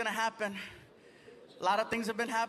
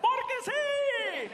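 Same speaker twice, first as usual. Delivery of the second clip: much higher, slow, stretched radio-host drawl; 14 semitones higher, 4 dB louder, much slower than usual. Retold or imitated. Radio host answering the comedian, high and slow. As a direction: stretch it out like the on-air DJ. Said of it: "why are you talking like that".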